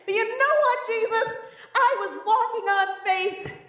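high, slow, laughing insistence; half-laughing high defence; protesting as Peter. Pretend. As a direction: argue your case to Jesus. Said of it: "I put myself in Peter's position".

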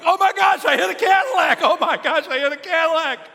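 high, loud panicked shouting; freaking out. Retold or imitated. Kid realizing he hit a Cadillac, freaking out loudly. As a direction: yelp it like a kid in total panic. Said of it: "And he's freaking out".

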